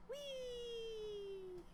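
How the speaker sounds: high squealing whee of flying; delighted little squeal; gleeful whee. Real, not acted. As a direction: let out a giddy high whee.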